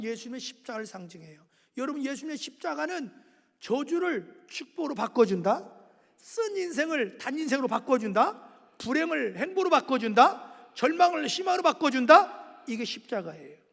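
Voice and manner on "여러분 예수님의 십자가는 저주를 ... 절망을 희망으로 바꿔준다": each line louder, preaching to a peak; building proclamation. Real, not acted. Preacher rallying the congregation, swelling to a loud climax. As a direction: build from firm to full-force preaching.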